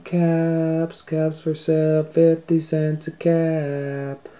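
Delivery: high, loud, slow sing-song street cry; peddler calling. Acted. Peddler calling his caps down the street, slow sing-song cry. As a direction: call out wares down the street, drawn out. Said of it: "walked down the street calling".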